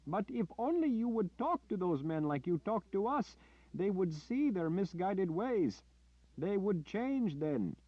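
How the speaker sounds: hope they can be talked round; naive villager. Acted.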